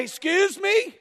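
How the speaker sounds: a hint of a stern father, pitched high; stern dad. Father calling up the stairs to a kid who answered huh, sharp and stern. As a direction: call up the stairs, demanding respect. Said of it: "I walk to the stairway and I say".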